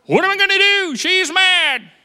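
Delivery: high, loud mock panic; hammed-up worried God. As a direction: play a flustered husband fearing his wife. God mock-panicking about wife.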